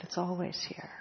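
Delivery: quietly repeating it to herself; soft echo. Real, not acted. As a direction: let it fall away to a hush. Echoing the line softly.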